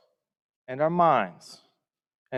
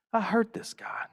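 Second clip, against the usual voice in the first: whispered thought; 8 semitones higher, 5 dB quieter than usual. Retold or imitated. Panicked inner thought, retold.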